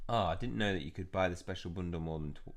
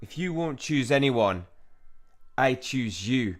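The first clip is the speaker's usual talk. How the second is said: slow, loud, deliberate menace; possessed villain; 5 semitones higher, 9 dB louder, slower than usual. Acted. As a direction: pronounce the sentence slowly and loudly. Villain picks a victim.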